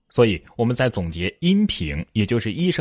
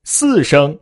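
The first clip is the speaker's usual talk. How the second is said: higher, louder two syllables; high key; 6 semitones higher, 6 dB louder than usual. Shown as a demonstration. Teacher showing any key is correct, high key. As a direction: lift the whole word up. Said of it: "我不管说".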